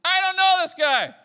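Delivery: high, full-force shout; exclaimed denial. Acted. Preacher voices Peter denying Jesus, shouted exclamation. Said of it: "it's even an exclamation point".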